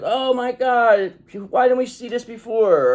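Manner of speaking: high, drawn-out dismayed "oh my God"; hammed-up dismay in a high voice; mock realization. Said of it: "Now people are seeing it's like".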